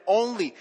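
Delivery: only pressed hard and held; stress on only. Shown as a demonstration. The pastor stresses that Christ is the only payment, stress on only. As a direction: lean on the word only. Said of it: "and I really stress the word only".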